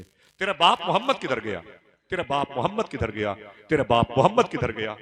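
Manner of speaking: loud, menacing mock demand; retold abusive taunting. Intruders demanding the father from his daughters, loud abusive taunting. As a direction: imitate intruders hurling a hostile challenge. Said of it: "वो गालियां दे रहे थे".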